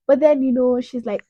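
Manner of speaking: ordinary delivery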